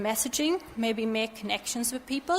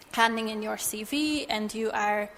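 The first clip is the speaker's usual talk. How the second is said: normal talking voice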